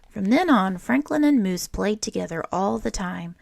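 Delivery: put-on lively read-aloud voice for children; storybook reading. A narrator's reading. Teacher reading a picture book to children, lively storytelling. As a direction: read it like story time for a class.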